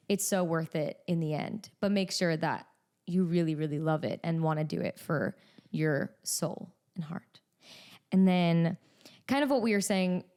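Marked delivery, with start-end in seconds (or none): 3.1-7.4 drops to a whisper on the heartfelt part; sincere whisper. Real, not acted. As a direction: hush it like a secret, from the heart. Earnest advice to teenagers.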